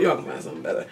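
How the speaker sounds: whispered aside. Real, not acted. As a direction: breathe it as a secret.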